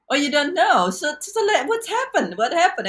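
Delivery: high, bright surprised coach voice; coach's surprised quote. Retold or imitated. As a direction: pitch way up for the coach's quizzing. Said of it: "She's like".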